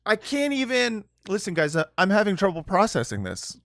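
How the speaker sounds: ordinary delivery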